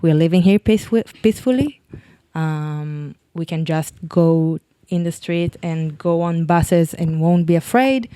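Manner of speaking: ordinary delivery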